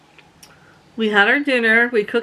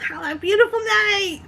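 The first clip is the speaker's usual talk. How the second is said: high, sing-song sarcastic farewell; sarcastic sweetness; 11 semitones higher than usual. Retold or imitated. Leaving the campsite, sarcastic sweet goodbye. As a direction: kill her with fake kindness. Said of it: "I yelled".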